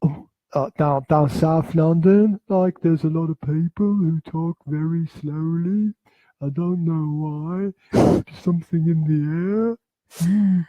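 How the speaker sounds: put-on lightly done South London accent, slow; a hint of South London, taking it slow. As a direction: drawl it out South London style. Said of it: "like, London accents".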